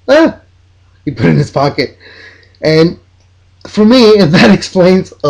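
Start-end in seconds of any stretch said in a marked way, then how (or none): 0.1-0.4 much higher, a sharp exclaimed Ah!; imitating a coach's gleeful grab; a quick hammed-up burst of delight; mock exclamation. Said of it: "He says".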